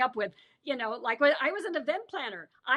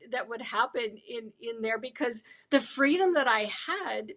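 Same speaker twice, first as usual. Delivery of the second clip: normal talking voice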